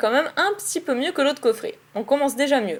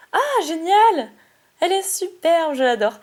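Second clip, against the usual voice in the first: delighted; 5 semitones higher, 3 dB louder than usual. Real, not acted. Delighted at a favourite card.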